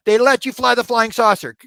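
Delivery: almost shout it in disbelief; incredulous outburst. Retold or imitated. Escalated repeat of disbelief.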